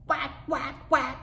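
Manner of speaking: put-on high baby voice, rat as whack; little brother's lisping chant; toddler saying rat. Baby brother copying his sister, high and lisping. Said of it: "He doesn't say his R's right yet".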